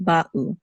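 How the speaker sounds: ba'u with a brief stop before the u; distinct syllables. Shown as a demonstration. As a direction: separate ba from u in ba'u. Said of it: "They should be distinct".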